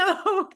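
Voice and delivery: a quick, loud, high laugh; short laugh. Real, not acted. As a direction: let a short laugh burst out. Laughs again.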